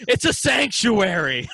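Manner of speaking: laughing mock-offended correction; indignant correction. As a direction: correct them huffily through a laugh. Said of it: "they're always like".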